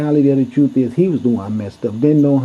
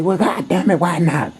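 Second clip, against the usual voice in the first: a hint of a cranky old man, higher and exasperated; mock the old man's griping; 4 semitones higher than usual.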